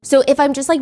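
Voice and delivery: usual speaking voice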